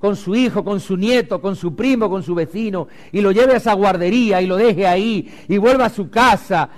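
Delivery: loud, ringing sermon cry; preaching at full force. Acted. Pastor urging parents to bring children, loudly preaching. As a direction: preach it out to the whole hall.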